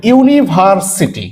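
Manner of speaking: ইউনিভার্সিটি drawn out slowly, full "you" sound at the start; teacher modelling ইউনিভার্সিটি slowly for the class; slow demo pronunciation. Shown as a demonstration. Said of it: "কি বলতো?".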